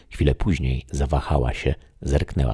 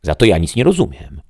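higher, louder puzzled reply; the shadow; 6 semitones higher, 6 dB louder than usual. Acted. The shadow answers the voice, higher and puzzled. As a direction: admit confusion. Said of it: "odparł cień".

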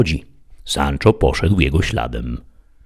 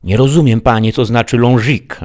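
a somewhat higher, puzzled servant voice; Sancho, the simple squire; 6 semitones higher, 4 dB louder than usual. Acted. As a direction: play the baffled squire asking his master. Sancho speaks in story.

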